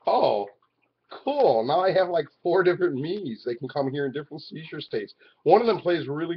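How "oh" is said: ordinary delivery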